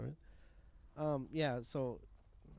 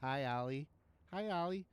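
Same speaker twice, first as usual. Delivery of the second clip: deadpan line; 2 semitones higher than usual. Retold or imitated.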